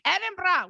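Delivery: high mock "Edinburgh" with a fake rolled R; mock your own "Edinburgh", ED-in-BRAH, high-pitched; silly high "Edinburgh", fake Scottish R. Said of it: "with my fake, like, rolled R".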